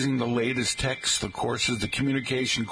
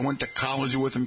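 ordinary delivery

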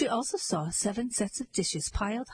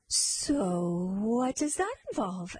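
slow, drawn-out mock curiosity; sarcastic; slower than usual. A narrator's reading. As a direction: stretch the question out with sarcasm. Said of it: "Your sarcasm is ill-advised".

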